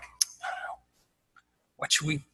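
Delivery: hushed, unsure whisper between friends. Retold or imitated. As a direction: whisper it as if afraid to be overheard. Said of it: "look at each other like".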